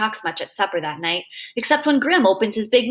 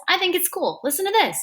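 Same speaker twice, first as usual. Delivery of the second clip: excited; 9 semitones higher than usual. Acted. Freak showing off his whistle.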